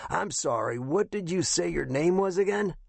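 high-pitched raspy veteran, questioning; rough, rasping captain. A narrator's reading. Retired captain asks the caller's name, rough rasping voice. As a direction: query it in a high, rough rasp. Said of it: "He had a rough, rasping voice".

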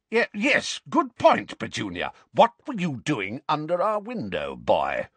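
high, stammering then blustering; flustered uncle. A narrator's reading. Uncle echoing his wife and rounding on the boy. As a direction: catch up with her, then bully.

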